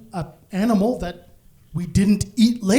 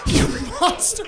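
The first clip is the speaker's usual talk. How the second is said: high-pitched hammed-up accusation; mock outrage; 7 semitones higher than usual. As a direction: squeal it as if deeply offended.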